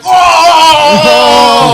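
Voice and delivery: loud, high mock crowd "Oh!"; imitated crowd gasp. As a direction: belt it out like a whole crowd wincing. Crowd reacting to the kick.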